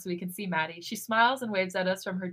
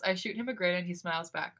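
ordinary delivery

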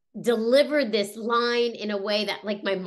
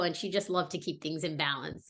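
ordinary delivery

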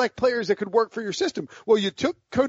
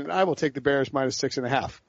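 ordinary talk, nothing marked

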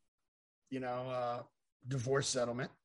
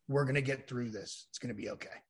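softer, hushed comfort fading almost to a breath; tender reassurance; 3 semitones lower, 7 dB quieter than usual. Retold or imitated. Ex-wife hugging him in line, softly reassuring. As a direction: soothe him while holding him close.

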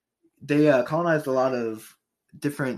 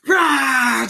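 loud high battle roar; war roar; 11 semitones higher, 8 dB louder than usual. Retold or imitated. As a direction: roar like charging soldiers. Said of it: "were just like".

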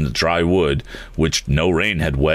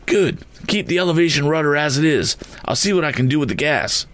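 much higher, firm instructions; balloonist advising; 10 semitones higher than usual. A narrator's reading. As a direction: give orders calmly but high. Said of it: "advised the balloonist".

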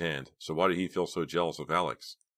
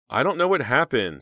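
higher, louder, a touch of fright; a little frightened; 9 semitones higher, 7 dB louder than usual. A narrator's reading. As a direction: sound slightly unnerved. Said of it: "She sounded a little frightened".

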